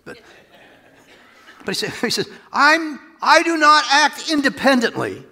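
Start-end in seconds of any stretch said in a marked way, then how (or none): 2.5-5.2 high and loud declaration; emphatic Jesus voice. Pretend. Preacher voicing Jesus to the crowd, raised and loud. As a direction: proclaim it with full force.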